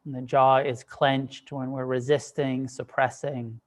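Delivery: unhurried meditation guidance with long pauses; guided meditation. Acted. Teacher guiding a group to soften and relax, guided meditation. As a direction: ease them gently into stillness, leaving space between phrases.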